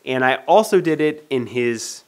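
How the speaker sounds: usual speaking voice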